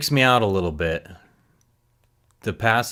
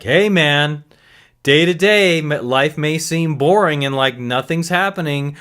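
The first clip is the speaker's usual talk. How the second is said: loud wake-up call; 7 semitones higher, 5 dB louder than usual. Retold or imitated.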